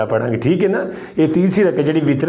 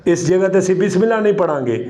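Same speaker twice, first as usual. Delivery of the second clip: usual speaking voice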